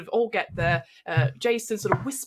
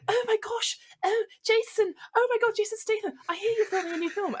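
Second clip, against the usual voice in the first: a much higher, squealing fan gushing over her idol; starstruck fan; 13 semitones higher than usual. Acted. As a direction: gush like a fan meeting her hero. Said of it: "And she's like".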